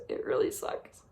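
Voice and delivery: barely breathing the words out, spent; broken whisper. Real, not acted. Admitting to viewers how much it hurts, whispered. Grief after dad's death.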